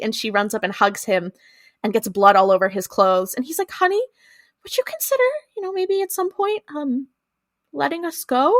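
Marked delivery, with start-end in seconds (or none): 3.7-8.5 high, softened, hesitant imitation of dad; timid pleading dad. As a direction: tiptoe into the request, pitched up. Voicing dad timidly begging.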